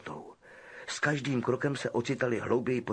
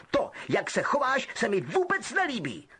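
higher outraged protest; pompous indignation; Percy demanding respect; indignant; 10 semitones higher than usual. Acted. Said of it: "pobouřeně".

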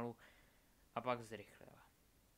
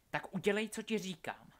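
raised, higher-pitched order; forceful command; 7 semitones higher, 6 dB louder than usual. A narrator's reading. Delphi threatens Albus into obeying her, insistent raised voice. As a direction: demand obedience with force. Villain orders tortured boy's friend to obey.

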